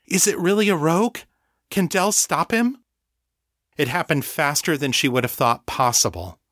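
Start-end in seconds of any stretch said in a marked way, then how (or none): 0.1-2.8 high, urgent questions; anxious questioning. A narrator's reading. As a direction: ask desperately in a raised pitch. Sasha frantically questions Trace.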